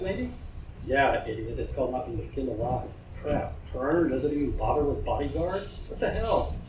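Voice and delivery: a hint of a cocky gang youth; gawking thug. A narrator's reading. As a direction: play the leering street punk. Thugs panicking about the boss.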